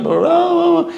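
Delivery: mock their endless whining; mocking the wailing families with sing-song blah.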